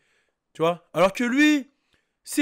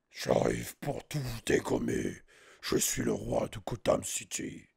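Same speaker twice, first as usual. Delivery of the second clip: very deep, slow, quiet growl; Batman; 11 semitones lower, 8 dB quieter, slower than usual. Acted. Imitating the caped hero claiming Gotham City, big deep voice. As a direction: drop far down and drag it out darkly. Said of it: "avec la grosse voix".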